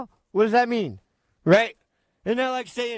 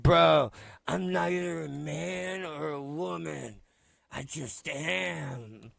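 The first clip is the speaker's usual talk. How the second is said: low, spaced-out stoner drawl; stoned hippie; 6 semitones lower, 7 dB quieter, slower than usual. Pretend. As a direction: play a dazed acid hippie. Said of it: "You sound like a fucking hippie tripping acid".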